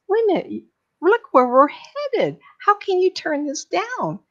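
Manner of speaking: can't believe he'd refuse; incredulous crowd. Retold or imitated. Crowd of souls urging him.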